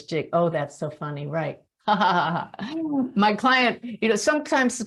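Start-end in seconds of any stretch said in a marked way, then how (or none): 1.9-3.1 short laugh. Real, not acted. Laughs at own funny story.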